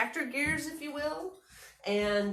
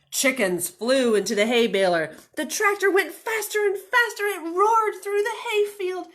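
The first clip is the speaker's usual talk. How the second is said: ordinary delivery, nothing marked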